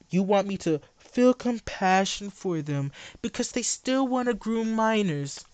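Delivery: ordinary talk, nothing marked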